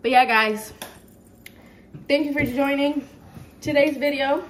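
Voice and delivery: loud upbeat sign-off; vlog outro. Acted. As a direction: address the viewers brightly.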